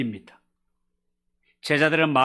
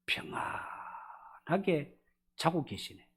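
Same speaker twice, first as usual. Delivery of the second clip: whisper; 13 dB quieter, much slower than usual. Shown as a demonstration. Jesus sleeping calmly in storm.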